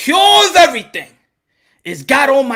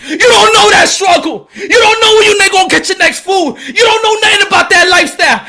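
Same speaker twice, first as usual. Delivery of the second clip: loud, raised street-preacher rant; passionate preaching; 4 semitones higher, 5 dB louder than usual. Real, not acted. To people who doubt his struggle, shouting preacher rant. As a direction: hammer the hard life at them.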